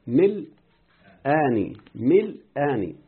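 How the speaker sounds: مل آني said slowly without the nun; dropped nun. Shown as a demonstration. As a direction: say مل آني slow, merging the words. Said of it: "قال حذف نون من". (نطق "مل آني" ببطء دون النون)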